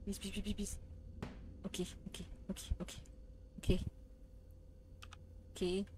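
very soft, low, rapid pleas; hushed anxious self-soothing; nervous muttering. Real, not acted. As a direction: mutter to yourself, scared. Tense pleading during scary game.

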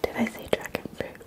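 usual speaking voice

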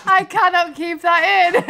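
laughing through the words; real laughter. Real, not acted. Vlogger reacting to friend's crude answer, real laughter. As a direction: speak while cracking up.

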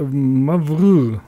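Mavr said hesitantly, as an unsure guess; unsure pronunciation. Real, not acted. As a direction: stumble over the word Mavr. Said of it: "Ich kann's gar nicht aussprechen".

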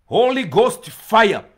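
loud, high, drawn-out chant imitating believers; preachers' fire cry. Minister mimics people calling for Holy Ghost fire, loud mock cry. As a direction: call it out like a zealous congregation. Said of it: "You hear people say".

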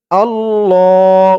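আল্লাহ held long and slow; drawn-out Allah. Shown as a demonstration. Teacher modelling আল্লাহ for students to repeat, slow and drawn out. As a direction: stretch আল্লাহ slowly with a long hold. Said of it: "তো বলুন আমার সাথে".

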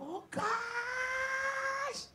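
high, long drawn-out gasp of delight; thrilled squeal. Acted. Friend reacts to the marriage charm, squealing. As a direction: stretch the last word into a high squeal.

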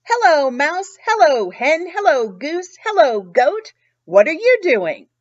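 loud, bright greeting from a friendly cow; storyteller booming out Cow's hellos to the animals; Cow's big greeting. Acted. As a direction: call out hello to each friend in turn. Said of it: "Along came Cow".

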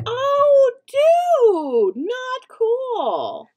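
loud, high, drawn-out protest; outraged "come on" shout; indignant. Real, not acted. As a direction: yell it in dismay, dragging the words. Trash can is knocked over.

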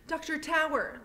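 put-on high, anxious girl calling for help; urgent young girl. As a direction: beg the doctor, breathless and worried. Lena pleading for sick grandmother.

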